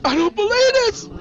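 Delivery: screaming in distress. Acted. Nisi screams on hearing Sandra is dead. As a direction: scream it in disbelief. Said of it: "she's screaming and she's yelling".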